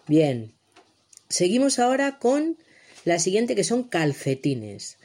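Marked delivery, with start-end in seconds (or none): none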